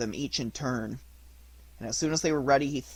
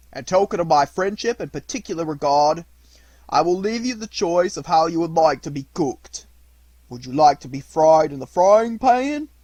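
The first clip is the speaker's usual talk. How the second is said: fisherman's voice; 3 semitones higher, 7 dB louder than usual. Acted.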